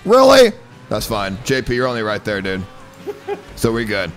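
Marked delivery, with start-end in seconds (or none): 0.1-0.5 loud, high-pitched outcry; frustrated shout. Real, not acted. Racer after a crash, frustrated shout. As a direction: yell in exasperated disbelief.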